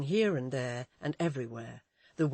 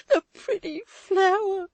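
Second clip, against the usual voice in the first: high, loud tearful cry; 11 dB louder than usual. A narrator's reading. Susan wringing her hands before Walter, high tearful cry. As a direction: sob it out. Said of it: "cried Susan".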